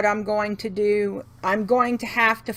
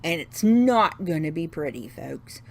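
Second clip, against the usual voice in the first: mock threat; 6 semitones lower, 4 dB quieter than usual. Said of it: "Ask my kids. I can be mean".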